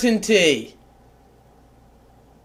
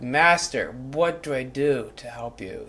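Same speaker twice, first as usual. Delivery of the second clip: devoted servant; 10 semitones lower, 11 dB quieter than usual. Pretend. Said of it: "that's kind of my energy".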